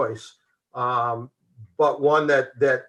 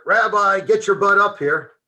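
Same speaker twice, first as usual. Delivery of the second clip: higher, louder shouted call; 7 semitones higher, 4 dB louder than usual. Retold or imitated. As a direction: yell across the parade deck. Someone calls him from the van.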